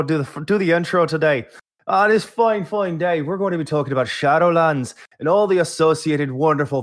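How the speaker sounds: mock-Scottish brogue that veers toward Irish; hammed-up Scottish accent. Host opens the show posing as co-host Chris, hammed-up Scottish accent. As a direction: play a Scot who sounds half leprechaun. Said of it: "That was a Scottish accent".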